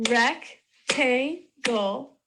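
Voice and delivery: "Rectangle" slowly split as rec, tan, gle; three stretched, separate beats in "Rectangle"; syllable by syllable. Shown as a demonstration. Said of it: "Let's try clapping these".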